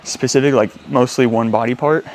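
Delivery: panting between words after a heavy set; out of breath. Real, not acted. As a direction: talk while still out of breath. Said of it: "sorry, I'm out of breath".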